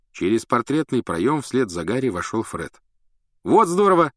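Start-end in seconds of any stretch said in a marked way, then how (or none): 3.4-4.1 high, loud and delighted; Fred cheering at the news; glad exclamation. A narrator's reading. Фред радуется походу в Хогсмид, радостно. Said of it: "обрадовался он".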